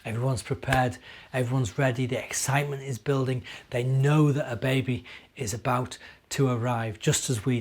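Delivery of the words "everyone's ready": plain reading voice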